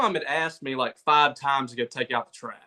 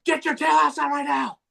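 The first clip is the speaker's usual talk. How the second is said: abrupt high-pitched yelled order; mock angry dad shout; 12 semitones higher than usual. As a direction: imitate a dad suddenly bellowing. Retold dad switches to yelling.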